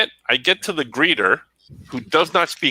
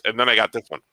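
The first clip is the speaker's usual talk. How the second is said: usual speaking voice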